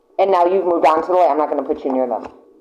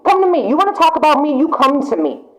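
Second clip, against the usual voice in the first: high, challenging come to me; confrontational; 8 semitones higher than usual. Retold or imitated. Querent dares gossips to confront her, challenging. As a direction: dare them to say it to your face. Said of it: "you are saying".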